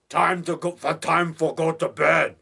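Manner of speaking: slow, halting imitation of Lobo's broken English; lightly voiced lumbering Lobo, slowly; Lobo impression. Horror fan quoting Lobo from The Unearthly, slow mock voice. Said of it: "He says that in The Unearthly".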